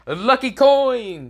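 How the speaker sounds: high, slow and drawn-out gleeful cry; hammed-up high excited shout; excited exclamation. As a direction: announce the lucky coin like a big reveal. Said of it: "Baruto says".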